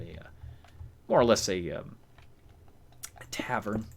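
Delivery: ordinary talk, nothing marked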